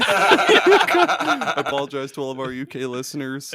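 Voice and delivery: loud laughter spilling into slow words; laughing apology. Real, not acted. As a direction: apologize through helpless laughter. Apology after bad accents, breaking up.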